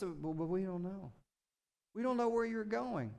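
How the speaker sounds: quiet, glum mock doubter; doubting Thomas. Thomas doubting Jesus, mildly glum and quiet. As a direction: mumble it like a defeated skeptic. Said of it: "And what's Thomas?".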